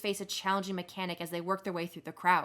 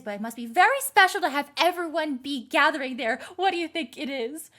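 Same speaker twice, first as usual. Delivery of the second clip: put-on higher, louder, fussy gossiping old lady; nosy old lady; 6 semitones higher, 5 dB louder than usual. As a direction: fuss over Grena like a busybody neighbour. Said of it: "nosy old ladies that you saw".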